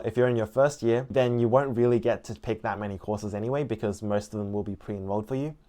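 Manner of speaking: normal talking voice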